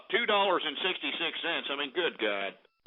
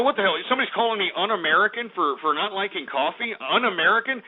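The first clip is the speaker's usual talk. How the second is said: ordinary talk, nothing marked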